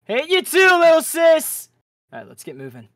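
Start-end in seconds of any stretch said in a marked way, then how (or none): 0.1-1.7 high, loud playful yell; teasing shout. Acted. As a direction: tease her at full volume.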